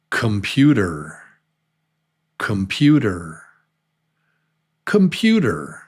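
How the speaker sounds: "computer" as kum-PYOO-der; "computer" with stress on PYOO, short first syllable; schwa in computer. Shown as a demonstration. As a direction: reduce the first vowel of "computer" to uh. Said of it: "this is the schwa here".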